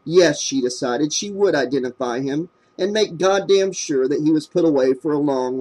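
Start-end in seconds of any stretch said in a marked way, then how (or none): none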